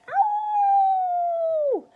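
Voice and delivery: very high, long, loud howl of pain; yelled. A narrator's reading. Grandma jolted by the medicine, yelling. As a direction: let out a sustained shrieking yell. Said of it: "Grandma yelled".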